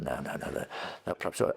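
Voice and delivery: hushed inner no, no, no; under-the-breath hesitation; whispered thought. Retold or imitated. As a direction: whisper it to yourself, backing off. Inner thought, talking himself out.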